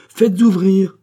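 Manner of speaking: loud, high, forceful order; insistent command. A narrator's reading. King insists to the queen on opening up, commanding.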